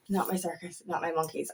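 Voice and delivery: a hushed calming mantra; murmured mantra. Shown as a demonstration. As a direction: repeat it quietly to yourself to let it go. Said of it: "something I have to repeat to myself".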